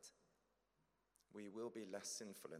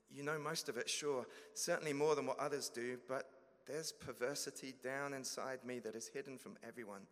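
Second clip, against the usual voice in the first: higher, louder pleading believer; anxious confession; 4 semitones higher, 6 dB louder than usual. Acted. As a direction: plead nervously, afraid of rejection.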